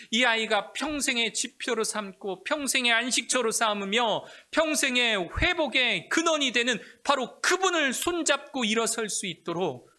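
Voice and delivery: high, loud, rising sermon cry; preaching at full force. Real, not acted. Pastor exhorting parents about children, full-force preaching. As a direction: build the preacher's fervor.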